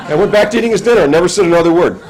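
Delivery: real chuckle through the words; amused laugh while telling the punchline. Real, not acted. Laughing at the grandson's remark.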